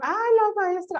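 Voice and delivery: high teasing ay, la maestra; mock someone calling you teacher at home; teasing exclamation. Someone teasing her at home, high teasing call. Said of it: "una vez me dijeron".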